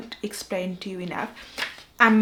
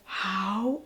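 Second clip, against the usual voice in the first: awed whisper; 3 semitones higher, 5 dB quieter than usual. Real, not acted. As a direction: whisper in wonder, on the edge of tears. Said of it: "I'm literally struggling".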